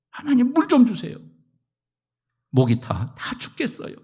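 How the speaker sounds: high, begging cry for water; pleading Israelites. Retold or imitated. Thirsty Israelites begging God for water, high pleading. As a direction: plead up to heaven.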